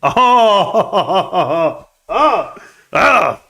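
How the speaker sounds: high "oh-ho-ho" guffaw trailing into gasps; big drawn-out laugh. Real, not acted. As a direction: laugh it out in long "oh" bursts. Laughs after mocking copium.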